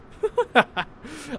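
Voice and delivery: short, high, loud laugh; real laughter. Real, not acted. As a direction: burst out laughing at yourself. Laughs at own Scottish line.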